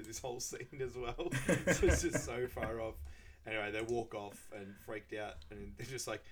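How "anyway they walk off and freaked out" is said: usual speaking voice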